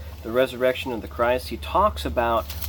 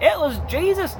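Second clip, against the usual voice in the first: southern twang; 14 semitones higher, 4 dB louder than usual. Retold or imitated. Preacher mimicking his own Southern slip, exaggerated twang. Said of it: "That was a very southern saying".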